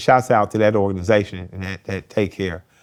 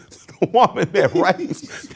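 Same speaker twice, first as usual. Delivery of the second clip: usual speaking voice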